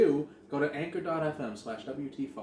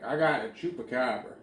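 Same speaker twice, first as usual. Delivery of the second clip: put-on Southern accent. Southern caller tipping off the news about his catch, thick Southern accent. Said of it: "Love doing that voice".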